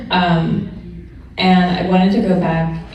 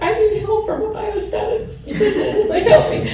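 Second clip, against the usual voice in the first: laughing plea. Retold or imitated. Asking the statistics professor for help, laughing high plea. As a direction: mock-desperate plea, much higher, laughing.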